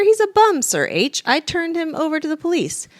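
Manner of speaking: loud, high defensive fox; indignant fox. A narrator's reading. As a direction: protest it shrilly. Fox argues with the bear.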